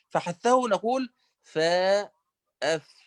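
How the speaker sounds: فاء then أف with breathy ف release; letter demo. Shown as a demonstration. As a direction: let the air run on أف. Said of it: "نقول هكذا". (نطق الفاء بالهمس)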